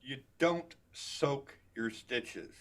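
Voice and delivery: ordinary talk, nothing marked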